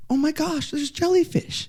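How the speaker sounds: high-pitched mock astonishment; imitated amazed guide. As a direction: play the guide spotting something wondrous, pitched high. Retelling guide spotting jellyfish.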